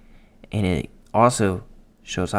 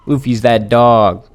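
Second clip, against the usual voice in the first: hyped; 2 semitones higher, 8 dB louder, slower than usual. Real, not acted. Hyped verdict on Luffy's kick.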